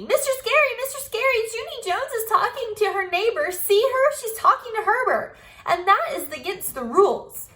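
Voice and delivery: very high, loud tattling whine; tattletale. Acted. May tattling to teacher.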